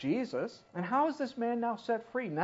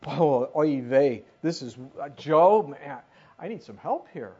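mock overwhelmed God; 4 semitones lower, 6 dB louder than usual. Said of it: "God's like".

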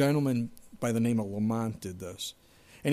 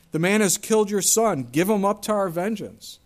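loud, higher vengeful demand; angry demand; 6 semitones higher, 8 dB louder than usual. Acted. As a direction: demand him back, furious.

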